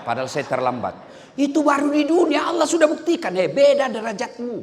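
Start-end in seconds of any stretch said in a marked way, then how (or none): 1.3-4.6 triumphant preaching. Real, not acted.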